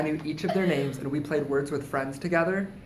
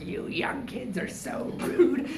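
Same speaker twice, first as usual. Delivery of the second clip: mock old man; 8 semitones higher, 3 dB quieter, slower than usual. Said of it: "And he's like".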